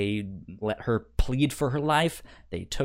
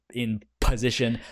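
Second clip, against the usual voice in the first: ordinary talk, nothing marked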